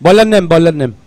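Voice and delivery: loud, insistent request over the PA; calling out. Real, not acted. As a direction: call out for the bowler's name. Calling out for bowler's name.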